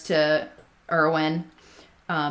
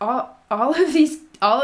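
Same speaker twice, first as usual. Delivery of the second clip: a light hint of mock British; 6 semitones higher, 4 dB louder than usual. Cohost noticing own fake accent, fading mock British. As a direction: trail off the posh voice mid-sentence. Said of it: "Why am I doing a British accent?".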